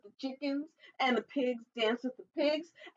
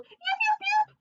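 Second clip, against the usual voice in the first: very high little fox yips; high squeaky fox call; 4 dB louder than usual. Acted. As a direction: yap it out like a small fox calling. Fox calls out in story.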